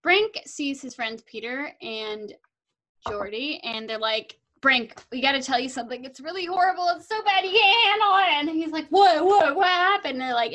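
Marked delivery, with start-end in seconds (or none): 4.6-8.3 dramatic alarm. Retold or imitated. Friends with bad news. 8.9-10.1 alarmed. Retold or imitated. Brink alarmed.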